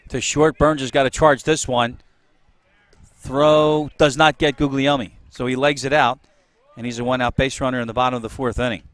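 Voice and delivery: play-by-play. Real, not acted. Live play-by-play call.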